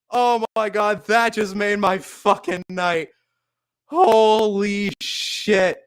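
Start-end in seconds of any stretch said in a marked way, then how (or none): none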